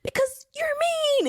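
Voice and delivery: high, pouty mock kid whine; high-pitched hurt child act; sad child. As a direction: imitate a sulking little boy accusing a parent. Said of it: "why are you still sad".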